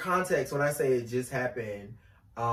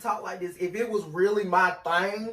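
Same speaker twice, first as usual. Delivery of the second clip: higher-pitched put-on Southern accent; 7 semitones higher, 6 dB louder than usual. Shown as a demonstration. North Carolinian showing how he'd love to talk, Southern accent. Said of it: "I could put on my Southern accent".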